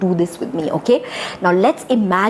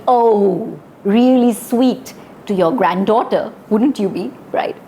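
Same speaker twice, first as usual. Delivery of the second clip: ordinary talk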